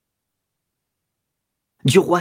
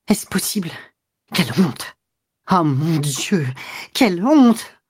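broken exclamations building to a high cry; scandalized, gasping out short phrases; indignation haletante; 3 semitones higher than usual. A narrator's reading. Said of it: "en haletant". (indignation haletante, qui monte)

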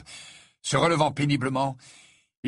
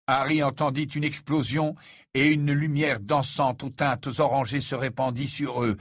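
usual speaking voice

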